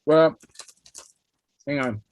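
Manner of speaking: talking through a mouthful of food; cheeks stuffed full. Real, not acted. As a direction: speak around a big bite. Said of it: "My mouth is full".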